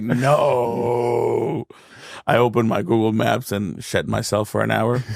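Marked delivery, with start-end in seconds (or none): 0.0-1.7 a cry of disbelief; half-hearted wail on realising the map; mock dismay. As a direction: let the realisation crush you. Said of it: "And I'm like".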